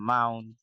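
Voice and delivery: মাউন্ট as "maun", first vowel and t left off; British pronunciation. Shown as a demonstration. Teacher repeating British amount, "maun". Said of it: "এ উচ্চারণ করে না".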